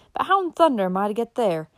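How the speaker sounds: a frustrated rider's grumbled question; exasperated exclamation. A narrator's reading. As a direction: wonder aloud in mild exasperation. Rider exclaims, lost in hills.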